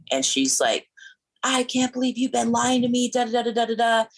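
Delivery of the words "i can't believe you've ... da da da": angry parent. Retold or imitated. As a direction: scold like a furious mom. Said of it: "marches up to me like an angry parent".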